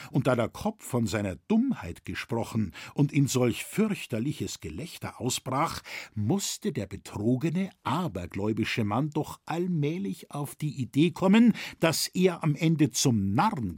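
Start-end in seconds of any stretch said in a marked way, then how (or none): none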